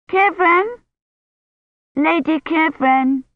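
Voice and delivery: put-on high little girl calling out. A narrator's reading. Agnes calls to missing Kivrin, calling out. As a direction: call her name from afar, high and drawn. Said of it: "Agnes called".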